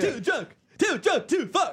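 high, slow, warbly punk-singer voice. Retold or imitated. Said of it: "Pretty much the same voice".

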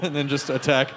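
normal talking voice

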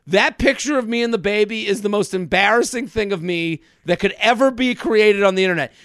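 ordinary talk

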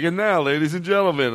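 mock emcee introduction; mock Jerry Lewis host. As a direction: lightly announce like a showman. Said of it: "the whole Jerry Lewis telethon".

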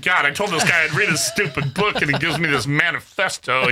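mock exasperation. Author voices host dreading his huge book, mock exasperated. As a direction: groan it out loudly, playfully put-upon.

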